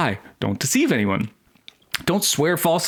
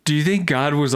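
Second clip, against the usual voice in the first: ordinary talk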